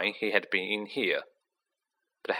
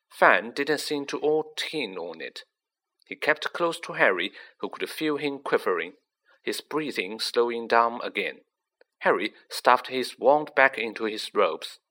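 usual speaking voice

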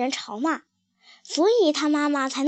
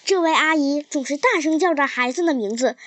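plain reading voice

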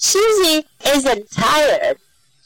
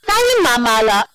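ordinary delivery, nothing marked

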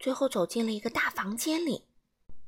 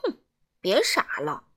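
scornful; 3 semitones lower than usual. A narrator's reading. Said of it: "傲慢先生亲密地说".

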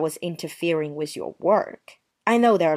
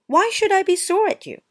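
sarcastic; 13 semitones higher, 3 dB louder than usual. Acted. Sarcastic denial.

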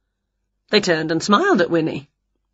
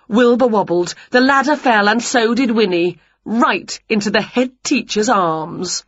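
usual speaking voice

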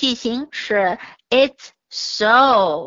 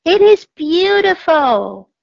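higher, louder awe; in wonder; 6 semitones higher, 7 dB louder than usual. A narrator's reading. Cat and mouse atop the tree, in wonder. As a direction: breathe out pure delight. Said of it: "said the cat and the mouse".

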